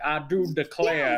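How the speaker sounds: a light put-on Southern drawl; mock Southern accent. Telling girlfriend how the actor sounds, lightly mock Southern. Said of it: "He sounds like Michael Scott when he does that".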